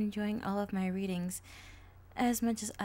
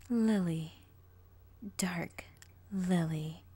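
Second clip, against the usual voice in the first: villainous self-naming; 5 dB louder, much slower than usual. Acted. Villain names herself.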